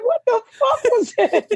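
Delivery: cracking up while retelling the shock; host laughing at a friend's on-camera spit; laughing disbelief. Real, not acted. Repeated quote, laughing.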